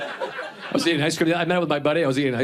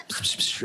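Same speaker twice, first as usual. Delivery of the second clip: soft voiceless snickering puffs; stifle a laugh in a dark cinema; quiet mock snicker; 6 dB quieter than usual. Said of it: "I'll be like".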